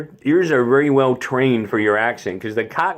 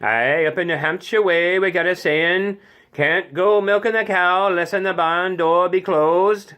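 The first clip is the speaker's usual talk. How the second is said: higher-pitched rural New Hampshire accent; 5 semitones higher than usual. Shown as a demonstration. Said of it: "New Hampshire's kinda like this".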